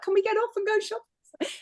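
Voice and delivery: put-on high childish begging; high, whining mock Colin begging to go shopping; whiny mock plea. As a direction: plead like a kid wanting sweets. Colin begs to shop.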